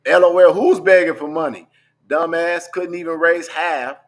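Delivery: loud taunting comeback. Retold or imitated. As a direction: throw the comeback at him with a sneer. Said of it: "my response to him was".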